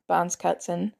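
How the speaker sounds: plain reading voice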